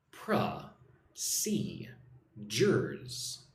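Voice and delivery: "Pra, si, jers" as three separate syllables. Shown as a demonstration. Teacher splitting a word for learners, "Pra, si, jers" syllable by syllable. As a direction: break it into "Pra", "si", "jers", pausing after each. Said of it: "Three syllables".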